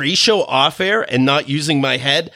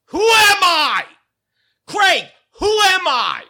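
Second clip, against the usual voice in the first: exasperated squeal; slower than usual. Real, not acted. Exasperated rant at Craig.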